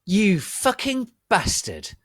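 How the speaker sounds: slow, high, loud anguished cry; moaned. A narrator's reading. Narrator to Draco, slow loud anguished cry. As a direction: wail it out betrayed. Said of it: "I moaned".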